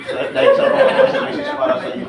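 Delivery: words squeezed out through a high laugh. Real, not acted. Speaker introducing services to OFWs, laughing through it. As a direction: laugh while you say it.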